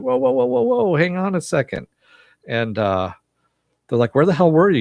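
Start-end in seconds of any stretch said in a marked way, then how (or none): 0.0-1.9 high-pitched string of whoas; mock panic, stalling for time; alarmed protest. Acted plea with guns pointed. 4.1-4.8 indignant demand. Retold or imitated. Acted confrontation of returning ally.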